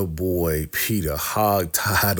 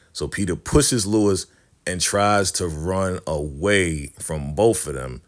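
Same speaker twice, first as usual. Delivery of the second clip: ordinary talk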